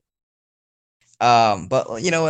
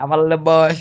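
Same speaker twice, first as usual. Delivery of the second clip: put-on higher, louder echo in a Southern accent; mock Southern accent; 5 semitones higher, 6 dB louder than usual. Player echoing the friend's mimicry, exaggerated Southern accent. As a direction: throw the Southern accent back, pushed up. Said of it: "you said it like".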